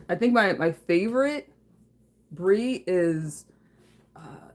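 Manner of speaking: ordinary delivery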